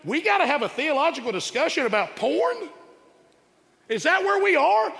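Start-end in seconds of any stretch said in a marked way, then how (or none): 3.9-4.9 exasperated. Real, not acted. Exasperated rhetorical question.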